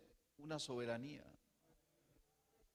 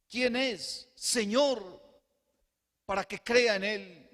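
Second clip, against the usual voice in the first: high, loud fervent cry; preacher's full-throated plea; loud impassioned question; 7 semitones higher, 10 dB louder, slower than usual. Retold or imitated. Same question retold at full force.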